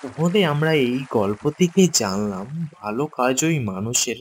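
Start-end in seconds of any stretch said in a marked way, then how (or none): none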